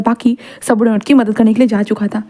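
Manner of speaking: ordinary delivery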